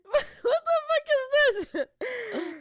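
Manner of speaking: high, loud and slow, breaking into laughter; howling with laughter in disbelief; laughing disbelief. Real, not acted. Shocked by ugly drawing.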